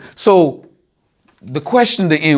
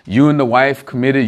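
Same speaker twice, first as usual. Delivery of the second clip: ordinary delivery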